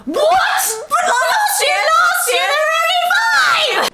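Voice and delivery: high, loud shriek of disbelief; shrieked outrage. Retold or imitated. Billy shrieking at Carlisle over new vampires. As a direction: screech it like a scandalised count. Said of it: "Billy's like".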